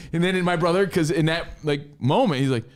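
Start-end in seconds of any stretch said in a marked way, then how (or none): none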